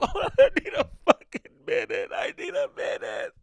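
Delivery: high, hammed-up tearful squeal; mock crying breakdown. Overwhelmed comedian begging for a minute, mock sobbing. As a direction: wail it, then choke down to voiceless.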